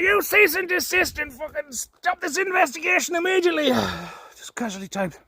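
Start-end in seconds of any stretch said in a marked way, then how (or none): 0.0-3.7 high, pompous parody of an officious demand; mocking falsetto order; mock troll voice. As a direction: squeak it out like a self-important keyboard warrior.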